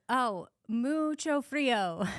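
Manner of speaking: mucho frío spoken very slowly, each word drawn out; slow, careful beginner Spanish, mucho frío; halting Spanish. Retold or imitated. Tourist telling Uber driver it is cold, in slow Spanish. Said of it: "So I say".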